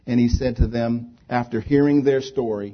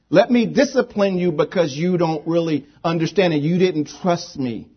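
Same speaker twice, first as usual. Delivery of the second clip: loud, higher-pitched mock reprimand; imagined scolding; 5 semitones higher, 4 dB louder than usual. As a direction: hammer out an acted telling-off. Mock scolding Jesus would not give.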